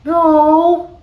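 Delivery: tiny frog's very high, loud squeak; peeping. A narrator's reading. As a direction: peep it out extremely high. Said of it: "peeped Frog".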